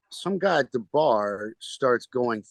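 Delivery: usual speaking voice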